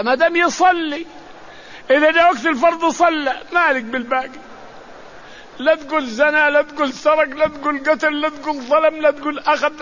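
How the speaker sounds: rising fervour, repeated warnings; emphatic preaching. Real, not acted. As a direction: drive home each لا تقول. Preacher pressing the point of obedience.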